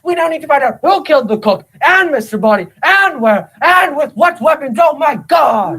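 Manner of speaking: high-pitched hysterical outburst; frantic shouting. Acted. Butler panics over two murders, frantic shouting. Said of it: "There's no need to shout".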